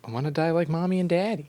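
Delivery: put-on childish whiny little girl voice; playful little girl voice; mock little girl voice.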